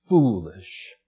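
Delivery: normal talking voice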